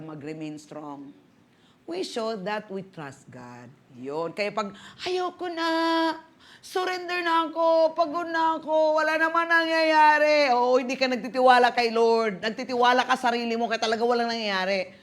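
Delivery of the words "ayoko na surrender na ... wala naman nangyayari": higher, whiny mock surrender; mock complaining. Weary believer telling God he quits, mock complaint. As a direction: imitate someone giving up, pitched up.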